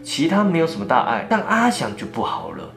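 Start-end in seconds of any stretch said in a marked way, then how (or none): none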